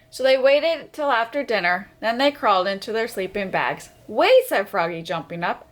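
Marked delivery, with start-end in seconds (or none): 4.1-4.5 high, loud sudden stop; sudden wait. Acted. Froggy jumping up in his sleeping bag, sudden. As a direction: jump in with a startled wait.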